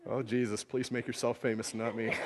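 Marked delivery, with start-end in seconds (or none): none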